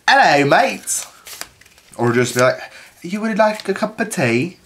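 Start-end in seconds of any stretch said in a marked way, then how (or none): 0.1-1.1 a playful put-on Australian hello; cheery fake Australian greeting, pitched up; mock Australian accent. Teasing his boss's European visitors in mock Australian. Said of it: "That's Australian". 3.0-4.6 put-on British tea offer, pitched up; mock British accent. Teasing the European visitors in mock British.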